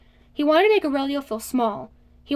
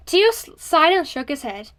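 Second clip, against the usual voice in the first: ordinary delivery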